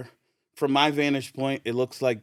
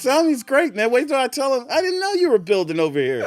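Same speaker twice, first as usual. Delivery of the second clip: high, loud, chummy officer; hearty old-acquaintance cheer; friendly cop; 12 semitones higher, 6 dB louder than usual. Retold or imitated. Cop tells Derek his brother is great, loud and high. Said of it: "And he's like".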